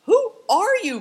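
very high, loud outburst; loud challenge leaping high in pitch; indignant cry. A narrator's reading. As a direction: cry out in outrage. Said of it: "cried the man".

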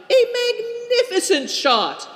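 very high, laughing cheer; delighted shout. A narrator's reading. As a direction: cheer the throw with thumbs up. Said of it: "and shouted".

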